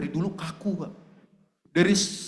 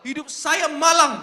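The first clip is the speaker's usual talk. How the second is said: mocking whiny self-pity; 8 semitones higher, 7 dB louder than usual. Mock complaint of self-pity.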